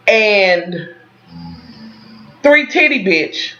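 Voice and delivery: ordinary delivery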